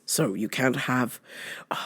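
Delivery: soft conspiratorial whisper; whispered aside. Real, not acted. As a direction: whisper it quietly. Unvoiced aside.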